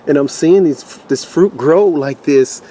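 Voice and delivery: thrilled gardener showing off her harvest; excited. Real, not acted. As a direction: let the joy spill over, near tears. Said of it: "I'm so excited right now".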